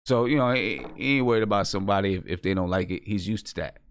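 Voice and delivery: normal talking voice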